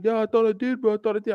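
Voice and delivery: high-pitched mock excuse; defensive excuse. Friend excusing himself for vouching, mock defensive.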